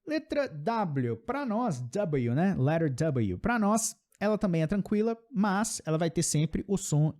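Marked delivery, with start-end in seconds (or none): none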